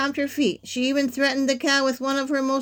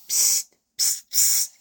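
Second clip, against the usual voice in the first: voiceless attention-getting "psst"; repeated whispered psst; slower than usual. A narrator's reading. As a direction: furtively hiss for attention. Wolf getting witch's attention.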